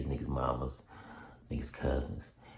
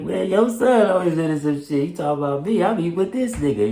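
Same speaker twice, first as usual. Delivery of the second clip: high, louder joking protest; retold laughing reply; 11 semitones higher, 5 dB louder than usual. Answering the homie's mama, high and playful. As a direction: toss it back high and playful.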